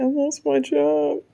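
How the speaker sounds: high, slow whimpered confession; imitating a pitiful child to mom; mock tearful. Tells mom the bad news.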